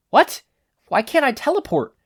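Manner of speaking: high, loud alarmed question; panic. Acted. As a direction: panic at the failed teleport. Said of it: "Izuku began to panic".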